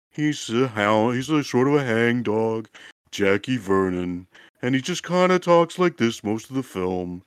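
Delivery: droop every word like a sad sack comic; Jackie Vernon impression. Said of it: "do a Jackie Vernon impression".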